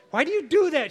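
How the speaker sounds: high, loud mock scolding wife; angry scolding. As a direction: scold him like an upset wife.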